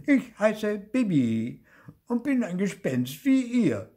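plaintive high ghost voice; whining little ghost. A narrator's reading. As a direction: introduce yourself in a miserable whine. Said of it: "jammerte das Gespenst".

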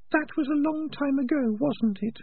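high, sharp little rat voice; Peaches voice. Acted. Peaches tells Morris, in a high rat voice.

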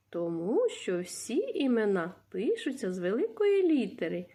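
usual speaking voice

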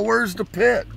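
baffled, needing to know; high surprised question; confused question. Retold or imitated. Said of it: "I go".

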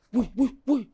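high, soft mimicked hushing; Latisa's scolding, imitated. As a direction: imitate a companion shushing the screamer. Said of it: "terus sama Latisa". (tiruan teguran pelan bernada tinggi)

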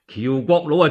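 plain reading voice

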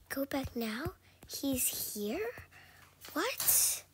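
hushed scared whisper. Real, not acted. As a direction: whisper it nervously. Creeping through scary level.

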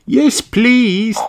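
put-on high, excited little-girl cheer; high-pitched happy cheer. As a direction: cheer a yes with delight. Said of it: "cheered Peppa and her family".

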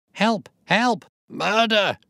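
high, loud desperate screams; shrieking cry of murder; scream for help. Acted. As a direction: scream as if being strangled. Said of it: "a sudden scream of".

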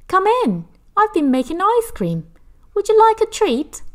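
cheery neighbour. Acted. Mrs Milton offering Hetty ice cream, high and loud.

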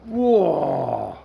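usual speaking voice

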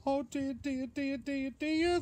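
very high storybook wail; despairing bear. Acted. Bear thinks he'll never eat, high mock despair.

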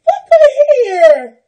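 high, loud, slow cry of delight; joyful shout. Real, not acted. Showing viewers a new outfit, joyful shout. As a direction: belt it out with delight.

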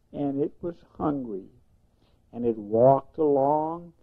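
usual speaking voice

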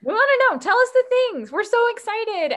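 high, loud and bubbly; excited. Real, not acted. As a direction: cheer the listeners on. Asking listeners, excited.

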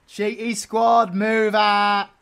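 loud, slow, drawn-out rally cry; like a commander sending out the troops; rallying call. Pretend. Ordering the squad out, a loud mock rally cry.

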